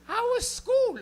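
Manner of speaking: high, loud mom question; squeaky mock-mom inquiry; immigrant mom. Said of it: "I have immigrant moms".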